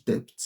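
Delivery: debt with the B sounded, "debt" as "dept"; Nigerian pronunciation. Shown as a demonstration. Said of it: "they will pronounce it as".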